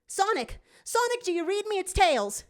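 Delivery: put-on high, eager young fox voice; high excited kid calling through a wrist radio; Tails calling. A narrator's reading. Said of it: "Tails phones in".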